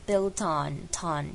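"built on" linked so t joins on as ton. Shown as a demonstration. Teacher links "built on" into ton. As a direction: run "built" into "on". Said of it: "做一个连音".

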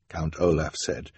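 usual speaking voice

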